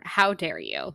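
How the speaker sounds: usual speaking voice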